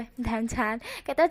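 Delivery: usual speaking voice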